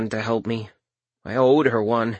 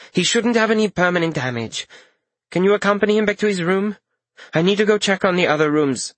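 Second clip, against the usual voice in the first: lightly raised, brisk healer; brisk professional healer, slightly higher; 8 semitones higher, faster than usual. Acted. Mender's voiced dialogue.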